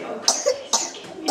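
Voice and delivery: whispered plea for forgiveness; whispered prayer. Retold or imitated. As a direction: breathe it like a confession. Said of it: "I'm just like".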